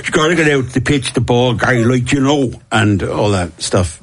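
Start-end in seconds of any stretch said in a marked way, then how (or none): 0.0-2.5 lightly voiced rugby coach barking orders; a hint of a gruff old Dublin coach; George Hook. Rugby coach calling to the boys from the sideline, lightly voiced. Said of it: "sounded a bit like George Hook".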